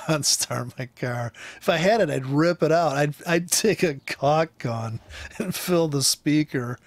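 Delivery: normal talking voice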